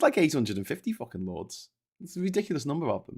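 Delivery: ordinary talk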